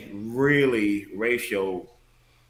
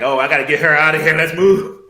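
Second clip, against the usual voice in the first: loud, rushed, high-pitched outburst; mock alarmed dad; 6 semitones higher, 11 dB louder than usual. Dad urgently moving daughter away, mock alarm. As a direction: blurt it louder and faster, imitating an alarmed father. Imitates panicked dad wanting to move.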